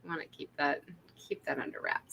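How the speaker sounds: mock conspiratorial whisper; playful whisper. Casting director whispers to keep the accent under wraps. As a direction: whisper it like a shared secret. Secretive tip continued.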